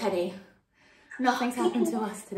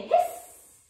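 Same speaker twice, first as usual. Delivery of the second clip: high playful cat hiss. Shown as a demonstration. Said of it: "Maybe you wanna hiss".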